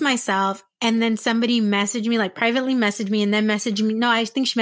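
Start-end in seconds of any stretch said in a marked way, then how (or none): none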